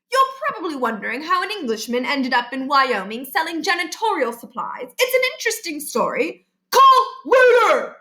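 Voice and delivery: put-on high, bright-toned English salesman accent; a cheery English cold-caller pitching glass cleaner; plummy English accent, chipper.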